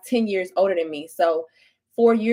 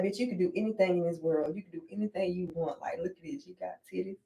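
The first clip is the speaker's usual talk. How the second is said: self pep talk; 5 dB quieter than usual. Retold or imitated. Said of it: "I be looking at my titties like".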